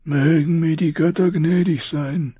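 high, strained voice of a dying king. Acted. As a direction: push each word out through pain. Robert prays.

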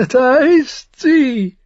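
very high, loud, slowly drawn out; gleeful madman's voice. A narrator's reading. Drunken brother spotting his sister, high drawn-out crowing. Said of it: "sagte er lächelnd".

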